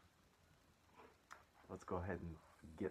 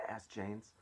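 ordinary delivery

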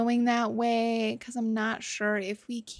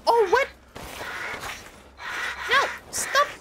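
high frantic pleading; scrambling to make it stop; panicked; 10 semitones higher than usual. Real, not acted. Gamer begs an attacking enemy to stop, high panic.

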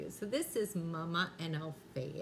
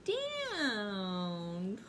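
drawn-out punchline; 6 semitones higher, much slower than usual. Acted. Knock-knock punchline to grandkids.

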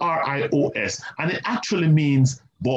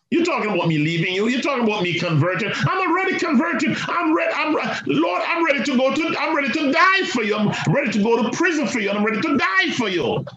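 high, fast, eager boasting, a touch hammed-up; boastful Peter; 10 semitones higher than usual. Peter boasting to Jesus he'll die for him, high eager voice. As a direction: Peter protesting he is already converted. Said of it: "Peter was in his braggadocious state".

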